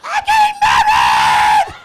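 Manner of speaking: shrill, slow, hammed-up party-girl shriek; drunk girl shriek. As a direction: scream it like a drunk bachelorette. Drunk single girls shrieking.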